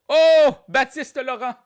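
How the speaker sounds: loud, high drawn-out burst; excited cheer. Real, not acted. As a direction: hail the winner with a big high oh. Host greets a winning answer.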